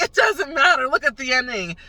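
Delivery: excited retort. Retold or imitated. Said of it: "and I'm like".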